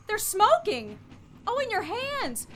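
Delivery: high, rising panicked concern; alarmed distress. Acted. Margot seeing Lamont's burned hands, panicked concern. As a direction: voice climbing in fear for him.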